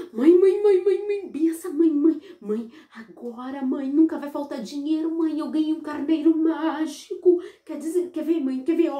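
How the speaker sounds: high, eager calling for mom; boy bursting in to tell mom about the magic ram, high and eager; excited child. Acted. Boy runs home excited.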